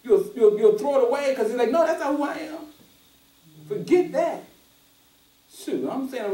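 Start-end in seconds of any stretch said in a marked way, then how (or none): 1.7-4.4 higher, firm brushing it off; a freed man turning sin down, high and firm; firm refusal. Retold or imitated. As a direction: push it away like it's nothing to you.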